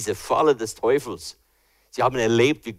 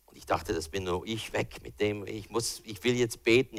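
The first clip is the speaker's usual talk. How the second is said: normal talking voice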